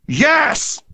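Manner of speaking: high eager shout of agreement; a crowd calling back yes; crowd cry. A narrator's reading. Boys answering Hunter's call, high shout. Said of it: "came the cry".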